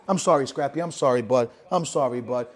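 low, hushed, soothing apology; soft apology. Real, not acted. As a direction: drop right down to comfort a woken companion. Soothing Scrappy woken by shouting.

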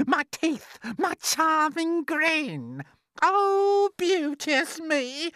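very high, slow, swooning self-praise; vain Aunt Spiker. A narrator's reading. Aunt adoring her own radiant looks, vain and high-pitched. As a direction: stretch out each boast, high and loud. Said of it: "Aunt Spiker said".

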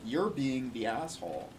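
ordinary delivery

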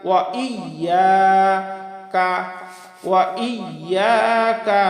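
slow "ওয়া ইয়াকা", separate I, long YA; sounded hamza. Shown as a demonstration. Teacher modelling the word, slow sounded hamza. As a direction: lean on the I after "ওয়া". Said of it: "হামজা ইয়া জের ই".

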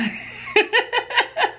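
a sudden high burst of laughter; real laugh. Real, not acted. Reading a funny comment.

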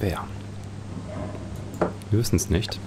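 normal talking voice

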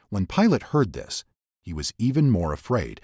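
ordinary delivery

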